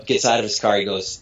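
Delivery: ordinary delivery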